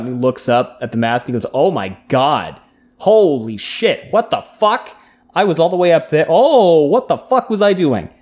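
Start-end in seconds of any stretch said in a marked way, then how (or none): 1.5-8.1 acted dawning shock. Rand realises his danger, acted.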